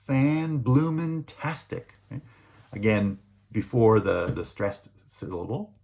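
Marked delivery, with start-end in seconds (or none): 0.1-2.0 fan-bloomin-tastic said slowly, higher, each part separated; slow demo. Shown as a demonstration. Lecturer slowly demonstrates the infix to students, higher and drawn out. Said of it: "fantastic can become".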